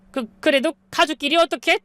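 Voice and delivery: high, stammering brazen appeal; brazen pleading. A narrator's reading. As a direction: stammer out a shameless family plea. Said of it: "뻔뻔한 소리를 하시기 시작했죠".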